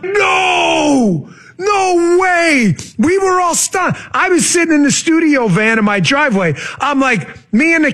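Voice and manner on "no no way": hammed-up disbelief, high shout; mock shocked shout. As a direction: fake stunned cry, much higher. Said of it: "I hope you sense the dripping sarcasm".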